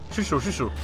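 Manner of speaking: ordinary delivery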